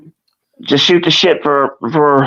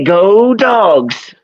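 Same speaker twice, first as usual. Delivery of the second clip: drawn-out, higher-pitched cheer; coaxing team cheer; 4 semitones higher than usual. Shown as a demonstration. Dad telling Abigail what to say, higher coaxing cheer. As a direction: lead the child in a long, lifted cheer.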